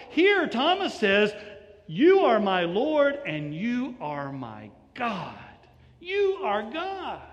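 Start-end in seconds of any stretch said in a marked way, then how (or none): 1.9-7.2 each word slow and weighty; preacher spelling out Thomas's confession slowly; slow emphatic proclamation. Shown as a demonstration. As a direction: lay every word down slowly. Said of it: "John wants you to hear that".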